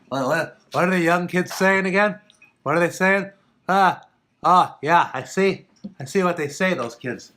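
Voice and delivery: put-on loud, high-pitched old man voice. Host mocking himself squinting at the chat, loud old man voice. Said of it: "I gotta do the old man".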